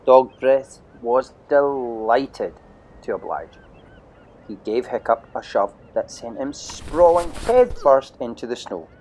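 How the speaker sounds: ordinary delivery, nothing marked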